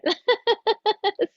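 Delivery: bright burst of giggling; giggle. Real, not acted. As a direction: break into giggles. Laughs at own mistake.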